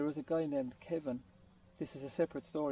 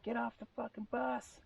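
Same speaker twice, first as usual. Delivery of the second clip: mock bus driver yell, pitched much higher; imitating an angry yell; 9 semitones higher, 3 dB quieter than usual. Bus driver throwing a crying passenger off, mock angry yell. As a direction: bark it out like a fed-up bus driver, retold. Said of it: "the bus driver was like".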